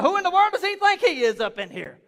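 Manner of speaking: scornful churchgoer with a laugh; mock indignant. Pastor imitating a judgy churchgoer, scornful laughing. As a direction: sneer at the newcomer. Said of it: "I'm like".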